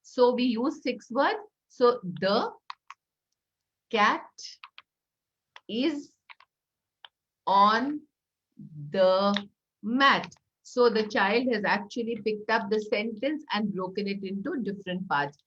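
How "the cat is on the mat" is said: slow, a pause after each word; one word per block, pausing. Shown as a demonstration. Teacher saying one word per block, spaced out. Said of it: "As I say a word".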